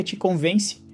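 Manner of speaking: ordinary talk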